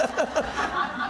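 high airy burst of laughter; a quick breathy laugh; breathless laugh. Real, not acted. Guest laughing at his own pulpit story, breathy laugh.

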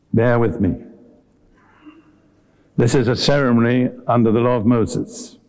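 ordinary talk

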